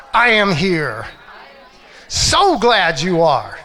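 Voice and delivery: "I am here" loud at normal pitch, the answer slow; loud greeting. Shown as a demonstration. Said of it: "I want you to say".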